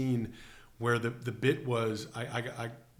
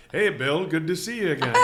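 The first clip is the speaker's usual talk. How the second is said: louder, slightly higher hammed-up hello; mock stiff greeting; 4 semitones higher, 8 dB louder than usual. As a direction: play an awkward actor greeting. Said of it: "now it's like".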